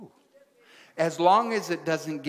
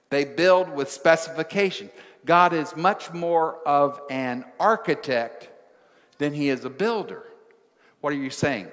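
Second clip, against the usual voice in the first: normal talking voice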